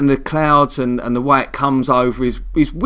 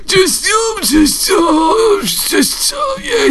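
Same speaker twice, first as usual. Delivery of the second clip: put-on much higher, slow, strained old man's croak; mock Michael Caine as a decrepit old man, high and quavering; feeble old man voice; much slower than usual. Shown as a demonstration. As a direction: wheeze it out like a very old man in a wheelchair. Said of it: "Make him talk like this".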